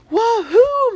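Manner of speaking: very high, very loud whoop of joy; boy whooping as the plane comes into view; joyful shout. Acted. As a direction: yell it to the sky in triumph. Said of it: "Matt shouted".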